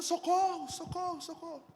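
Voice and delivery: high shouted cries for help, fading away; calling for help. Retold or imitated. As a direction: cry out for help in the street. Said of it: "a gente saiu gritando na rua".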